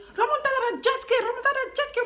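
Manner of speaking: high, loud mock Spanish guide; imitating the jet ski man. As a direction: squeal it like the excited instructor. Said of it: "Le mec, il me dit".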